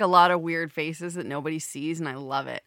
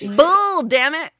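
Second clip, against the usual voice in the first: loud curse; 8 semitones higher, 9 dB louder, much slower than usual. Real, not acted. Mock curse at the mention.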